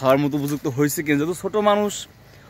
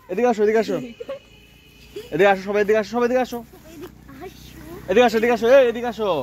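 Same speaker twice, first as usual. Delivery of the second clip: yelling across the room; 5 semitones higher than usual. Real, not acted. Calling the kids over outdoors. (দূর থেকে ডাকার মতো উঁচু গলা)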